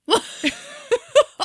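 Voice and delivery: high burst of real laughter. Real, not acted. Reacting to a heckle, real laughter. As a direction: crack up. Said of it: "laughing at the joke".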